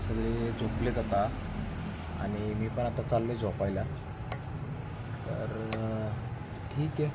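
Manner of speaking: hushed so others can sleep; quiet at night. Real, not acted. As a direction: keep it low, people sleeping nearby. Everyone asleep, talking at night.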